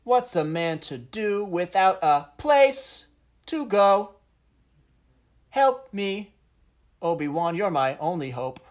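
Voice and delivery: high, booming declamation with long-stretched words; hammed-up slam poet, slow and loud with dramatic pauses; poetry slam. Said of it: "what would that sound like".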